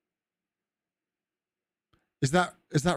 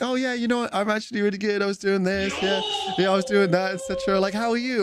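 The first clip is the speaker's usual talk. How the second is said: chatty tourist reply; 8 semitones higher than usual. Retold or imitated. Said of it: "They'll stop and be like".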